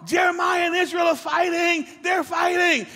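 put-on high, loud calling out of a little girl; tattling granddaughter. Granddaughter calling grandpa to report her brothers fighting, high tattling shout. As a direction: yell for grandpa like a kid telling on her brothers.

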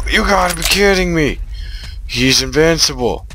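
high, fast frustrated yelling; outraged that it won't die; exasperated outcry. Real, not acted. Gamer losing to an invincible enemy, high frantic cry.

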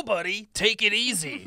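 usual speaking voice

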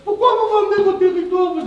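high, sharp mimicry of the banker's question; mimicking banker. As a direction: put on a prim banker's voice. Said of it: "si la banquière me demande".

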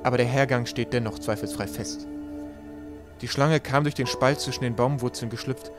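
usual speaking voice

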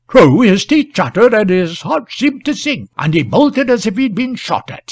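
slowly summing up. A narrator's reading. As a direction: weigh each clause before the next. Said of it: "slowly summed up the man in the chimney corner".

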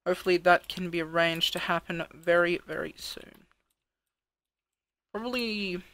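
usual speaking voice

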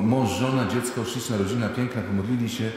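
ordinary talk, nothing marked

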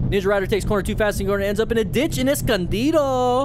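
high, loud put-on accent on Escondido. Real, not acted. Host hyping a rider's crash in Escondido, in a put-on accent. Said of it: "why I had that accent".

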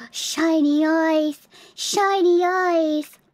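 slow, drawn-out awe; starry-eyed wonder. Pretend. Streamer showing off shiny eyes expression, awed. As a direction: stretch each word in dreamy admiration.

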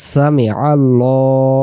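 সামি আল্লাহ with a dark, full lam; slow recitation with a heavy ল; rounded heavy Allah. Shown as a demonstration. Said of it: "মুখটা ... গোল হবে".

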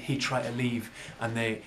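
ordinary delivery

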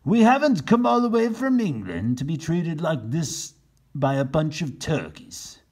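loud offended captain; indignant rant. Acted. Captain Bill ranting to his men, loud and offended. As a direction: rant with wounded pride. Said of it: "said Captain Bill".

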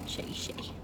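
breathy murmur to a nervous pet; whisper. Real, not acted. Owner whispering to the scared dog Shay Shay. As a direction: softly whisper the dog's name.

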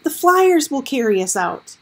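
loud urgent shout. A narrator's reading. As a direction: shout over the roaring water. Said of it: "Howard shouted".

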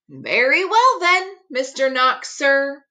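ordinary delivery, nothing marked

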